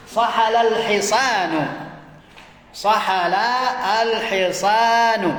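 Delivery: Sahala al-hisanu drawn out, slowly; drawn-out classroom dictation. Shown as a demonstration. Teacher dictating answer slowly.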